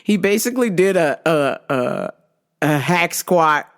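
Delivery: ordinary talk, nothing marked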